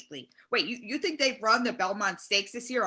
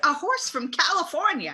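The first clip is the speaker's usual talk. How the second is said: exasperated answer; 5 semitones higher, 3 dB louder than usual. Acted.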